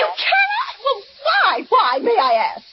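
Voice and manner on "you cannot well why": shrill outraged shriek, much higher; indignant shout. Acted. Invalid woman shrieking at the operator in outrage. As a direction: scream your disbelief at her.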